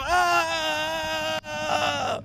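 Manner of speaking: sustained high-pitched holler; drawn-out playful "aaah"; long high yell. Pretend. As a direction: let out one long running yell. Running along for high fives.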